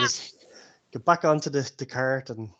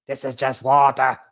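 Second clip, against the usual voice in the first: put-on louder, complaining old lady; grumpy old woman; 7 dB louder than usual. Old woman complaining about her cordial. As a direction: spit the words out in disgust.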